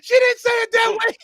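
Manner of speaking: high, loud comic outrage; shouted mock protest. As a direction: yell it like an indignant objection in court. Comedian's acted courtroom protest.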